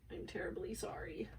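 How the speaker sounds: softly whispered, breathy aside; whispered apology. Real, not acted. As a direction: whisper a sorry to the viewers. Whispered apology mid-video.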